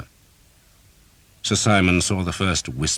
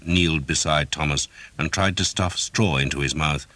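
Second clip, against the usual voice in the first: ordinary delivery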